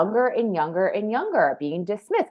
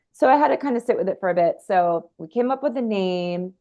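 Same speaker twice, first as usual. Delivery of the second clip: normal talking voice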